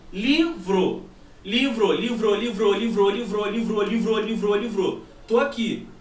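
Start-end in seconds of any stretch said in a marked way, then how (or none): 0.1-1.0 LIVRO stretched slowly, syllable by syllable; drawn-out word. Shown as a demonstration. Physics teacher sounding out the example word, drawn-out. 1.4-5.0 LIVRO chanted over and over on a level pitch; imitated echo. Shown as a demonstration. Teacher imitating sound bouncing off a far surface, echo chant. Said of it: "O anteparo de reflexão tá longe".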